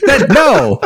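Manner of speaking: incredulous rant at a coworker's dumb idea; exasperated outburst. Real, not acted.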